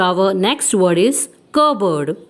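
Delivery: ordinary talk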